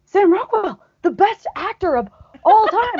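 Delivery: high, showy fan gushing; hammed-up loud praise, pitched high; loud for overhearing. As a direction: project it so the star overhears. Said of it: "I said it loud enough, hoping he would hear".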